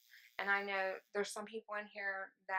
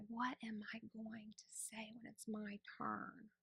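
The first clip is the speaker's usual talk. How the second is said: 1 whispered worry; 9 dB quieter than usual. Retold or imitated. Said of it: "and I'm thinking".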